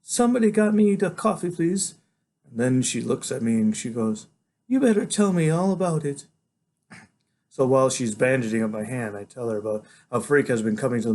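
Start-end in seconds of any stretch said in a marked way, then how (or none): 0.0-2.0 high woman's voice with a sigh; higher-pitched, worn-out request; weary doctor. A narrator's reading. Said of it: "sighs and says". 4.7-6.3 higher, gentle character voice; concerned woman doctor. A narrator's reading. Doctor gently asks the boy.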